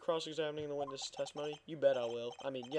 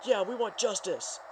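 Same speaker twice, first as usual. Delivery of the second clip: crowd shouting; 5 semitones higher than usual. Acted. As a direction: call out for justice from the gallery. Crowd demands justice in court.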